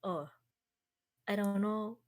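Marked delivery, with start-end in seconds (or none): none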